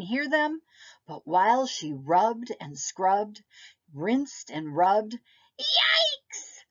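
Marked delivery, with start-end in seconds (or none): 5.6-6.6 very high squeaky yelp; cute germ voice. Acted. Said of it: "screamed the germ".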